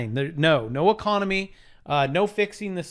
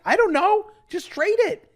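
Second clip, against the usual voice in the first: high, loud carefree answer; breezy shrug; 13 semitones higher, 5 dB louder than usual. Pretend. Telling viewers to just trade, high and breezy. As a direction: brush it off with a bright shrug.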